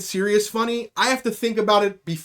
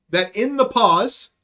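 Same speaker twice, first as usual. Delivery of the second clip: ordinary talk, nothing marked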